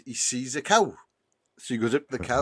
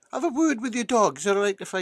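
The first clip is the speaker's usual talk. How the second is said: higher-pitched repeated request; insistent repeat; 10 semitones higher, faster than usual. Acted. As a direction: say it higher for someone who didn't get it. Inspector repeats after farmer's What.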